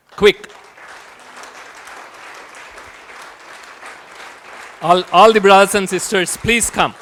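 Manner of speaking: calling the volunteers to come up, loud. Real, not acted. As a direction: call them over across the hall. Calling team onto the stage.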